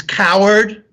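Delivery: high-pitched, angry shout; angry scream. A narrator's reading. Son angered at the response, angry scream. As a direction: hurl it at the unseen voice. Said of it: "he screams".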